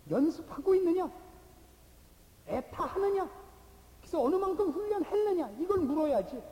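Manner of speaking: high, loud, driving rhetorical questions; impassioned preaching. Real, not acted. Preacher challenges believers to train and thirst, impassioned. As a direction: hammer each question home from the pulpit.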